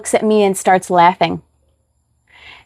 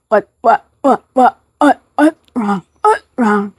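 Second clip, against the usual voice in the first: seizure stutter. Retold or imitated. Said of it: "you just see me jerking like this".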